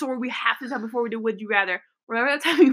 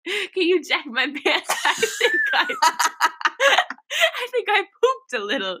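put-on slow, higher, giggly British accent; imitated British accent; 7 semitones higher than usual. A friend retells Emma asking her to check her pants, giggly British accent.

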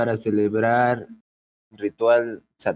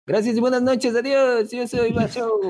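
much higher, bright mock announcer farewell; mock show sign-off; 13 semitones higher than usual. Guest closes his answer like a radio host, high and hammed-up.

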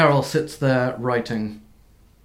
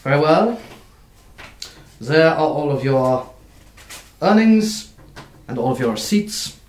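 rushed, clipped-off officious clerk, louder; brisk busy clerk; 3 dB louder than usual. Acted. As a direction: een drukbezette klerk die iedereen snel afhandelt. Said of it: "and goes".

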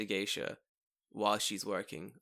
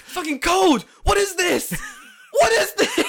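much higher, much louder shrieking chef; Gordon Ramsay yell; 12 dB louder, slower than usual. Retold or imitated. Parodying the celebrity chef, hammed-up shriek. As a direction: bellow at the cook like a furious chef. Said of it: "Gordon Ramsay impression".

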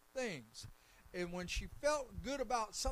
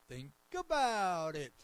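loud, slow imitation with a long stretched ABOUT; put-on voice, swooping down through a drawn-out ABOUT; church lady's call-out; 7 dB louder, slower than usual. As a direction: mimic a congregant hollering from the pews. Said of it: "you'll probably be going".

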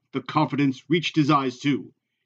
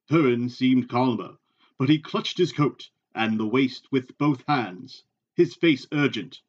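ordinary talk, nothing marked

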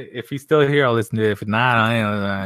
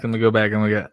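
ordinary talk

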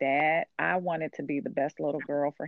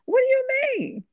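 a sudden, much higher and louder what do you mean; incredulous outburst; 6 dB louder than usual. Retold or imitated. Wife retorting to her husband, in high, loud disbelief. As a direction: shoot back at him in disbelief.

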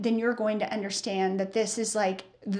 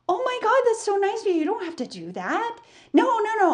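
much higher, bubbly customer protesting; gushing customer; 10 semitones higher, faster than usual. Retold or imitated. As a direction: imitate the friendly customer gushing.

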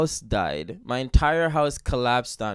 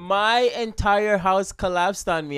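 loud, higher, drawn-out defence; exasperated protest; 5 semitones higher, 7 dB louder than usual. Real, not acted. Co-host defending shoes after house collapsed, exasperated protest. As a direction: protest loudly, stretching it out with a laugh.

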